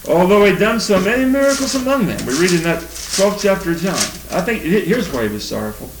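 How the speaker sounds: normal talking voice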